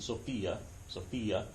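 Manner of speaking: Sophia repeated softly as so-FEE-uh; Greek pronunciation. Shown as a demonstration. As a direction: model Sophia for students, softly. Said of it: "That's exactly how you pronounce it".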